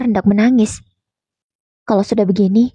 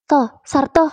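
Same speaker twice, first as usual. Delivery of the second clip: a raised, higher call across a noisy line; shouting down the phone; 5 semitones higher, slower than usual. A narrator's reading. Kuntet yelling over the phone to his friend, raised call. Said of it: "teriak seseorang dari seberang sana".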